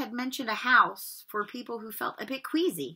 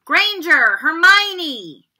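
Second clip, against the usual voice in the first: higher, louder, slow name call; 7 semitones higher, 12 dB louder, much slower than usual. Acted. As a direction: draw the name out to the hall. Calling names.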